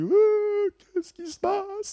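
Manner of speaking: high, loud, slow startled "ouh"; astonished cry. Retold or imitated. Recalling the Holy Spirit moment, a high amazed cry. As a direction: gasp it out, amazed at what's happening. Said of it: "Je dis".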